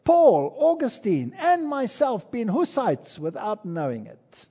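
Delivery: quoted cry of joy, starting high and loud; joyful exclamation. Retold or imitated. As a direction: proclaim each name with delight, then ease off. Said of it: "he exclaimed in his joy".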